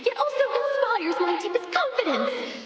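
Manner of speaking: high, strained and close to tears; exasperated outburst. Acted. Empress venting her torment to the Senator, voice near breaking.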